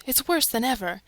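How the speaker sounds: plain reading voice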